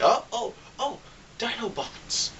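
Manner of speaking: fan finally rewarded; excited relief. Retold or imitated. Viewer two hours in seeing Dinobots, excited high relief.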